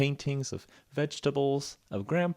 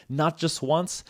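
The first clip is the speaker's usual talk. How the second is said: ordinary delivery, nothing marked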